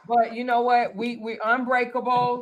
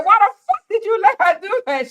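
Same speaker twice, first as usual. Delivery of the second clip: high, louder re-enacted complaint; frustrated outburst; 10 semitones higher, 5 dB louder than usual. Retelling her call to Denise, frustrated outburst. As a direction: demand it high and loud, exasperated. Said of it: "I'm gonna let my frustration out".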